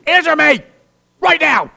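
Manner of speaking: stern parent yell. Acted. Preacher voicing a parent, yelling at his kid. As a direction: bark it like a fed-up parent.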